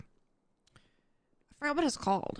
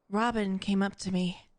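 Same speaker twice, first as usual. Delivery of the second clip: character voice; 2 semitones lower, slower than usual. Retold or imitated. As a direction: drop into a gruff character voice. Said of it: "the character voices are like".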